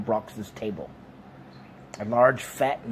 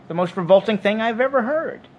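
high, loud disgust; loud, scandalised outburst; appalled exclamation; 10 semitones higher, 6 dB louder than usual. A narrator's reading. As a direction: protest in raised-pitch revulsion. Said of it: "exclaimed Arthur".

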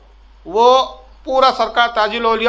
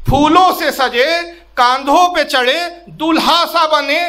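raised, declaimed verse, line by line; poetry recital; 4 semitones higher than usual. Acted. Disciple's last verse to his saint, declaimed in recital. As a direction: declaim the closing couplet, higher and measured.

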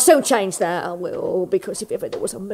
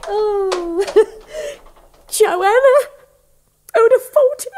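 high, loud laughing cry of joy; overjoyed; 8 semitones higher, 4 dB louder than usual. Acted. Landlady to barber, overjoyed at the news, laughing. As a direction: laugh and sob with sudden delight.

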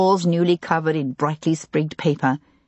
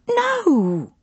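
high anguished cry of no; distressed cry. A narrator's reading. Housekeeper asked who wished them harm, crying out high and anguished. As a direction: cry it out, higher, in despair. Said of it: "she cried".

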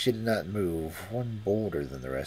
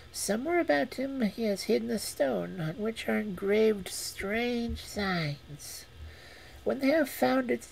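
cat voice. Acted. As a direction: purr it like a sly cat. Cat gives instructions.

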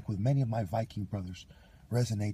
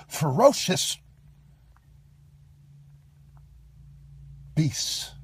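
rising higher and louder word by word; building tirade; 8 semitones higher, 3 dB louder, slower than usual. Real, not acted. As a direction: hurl each insult slower and harder. Escalating list of insults.

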